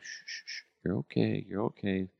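soft soothing shush; father shushing his fussy baby softly. Retold or imitated. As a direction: hush a fussy baby gently. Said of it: "trying to shush him".

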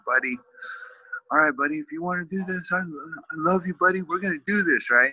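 fighting back tears while hugging his son; tearful dad promising his son support; choked up. Retold or imitated. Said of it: "I started getting emotional".

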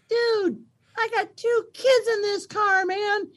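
put-on very high tiny-kid voice copying dad; three-year-old daughter. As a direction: squeak it like a toddler parroting her father. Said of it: "who's three, go".

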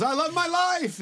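very high imitated yell; retelling a kid's full-voiced yell; shouted affirmation. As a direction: call it out into the dark street. Retold kid shouts affirmation.

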